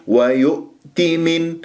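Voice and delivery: ordinary talk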